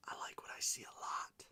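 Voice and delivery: soft whispered delight, extended. Real, not acted. Whispering delight at a good card to viewers. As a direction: breathe it out, savoring it.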